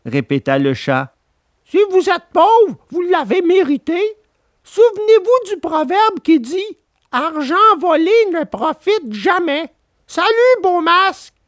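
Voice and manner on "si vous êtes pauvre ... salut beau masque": high puppet voice, cheeky farewell; Pinocchio mocking. A narrator's reading. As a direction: brush off the swindlers. Pinocchio lectures them.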